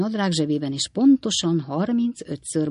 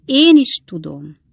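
knowing commiseration; 9 dB louder, slower than usual. A narrator's reading. Second neighbour echoes commiseration.

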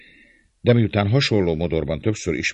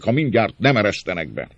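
raised, higher threatening voice; shouted threat; 6 semitones higher, 5 dB louder than usual. A narrator's reading. Rioter demanding to be let in, shouting. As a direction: press the threat loudly. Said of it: "kiáltott egy másik".

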